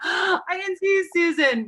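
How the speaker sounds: high gasping surprise; delighted surprise. Real, not acted. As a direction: gasp as you spot a friend. Suddenly spots a friend.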